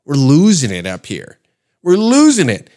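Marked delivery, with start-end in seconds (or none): 1.8-2.7 high, loud hysterical outcry; mock panicked yell. Canadians up in arms over tape, mock panicked yell. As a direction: blow up in exaggerated panic. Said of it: "it's got everybody up in arms".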